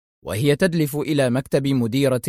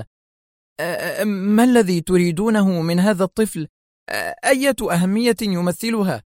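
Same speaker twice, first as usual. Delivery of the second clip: higher, tearful, faltering questions; pale, terrified voice; 5 semitones higher than usual. A narrator's reading. Pale principal asks intruders about the child, terrified. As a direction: stammer it as if drained by fear. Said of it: "بصوت أشد شحوبا منها".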